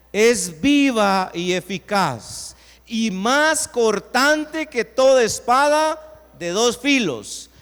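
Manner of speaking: loud and slow, each phrase weighed; preacher booming the verse slowly; slow preached proclamation. Acted.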